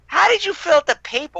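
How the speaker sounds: mock incredulous question. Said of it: "I'm like".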